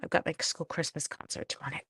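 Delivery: whispering. Real, not acted. Whispering to camera about concert.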